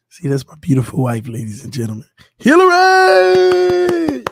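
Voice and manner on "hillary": long, stretched, high ring-announcer cry; announcer call. Pretend. Husband presenting his wife to viewers, mock ring-announcer introduction. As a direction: belt the name out like a ring announcer.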